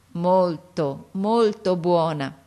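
slow, deliberate words, each one worked out; a deaf girl proudly forming each word; slow careful speech. Acted. Daughter telling her father, slow and deliberate.

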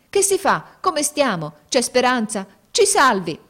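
high, loud frantic questions; panicked pleading. Acted. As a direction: beg the captain in terror. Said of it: "gridarono tutti insieme".

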